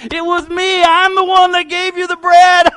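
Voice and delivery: high, loud gloating brag; gloating taunt. Retold or imitated. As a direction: rub it in triumphantly. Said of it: "young man's right there saying".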